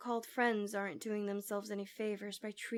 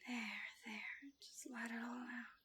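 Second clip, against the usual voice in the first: soothing whisper; 9 dB quieter than usual. Acted. Comforting a crying listener.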